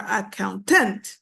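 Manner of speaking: "accountant" wrongly stressed a-coun-TANT; misplaced stress. Shown as a demonstration. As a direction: throw the weight onto final TANT in "accountant". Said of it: "You do not say".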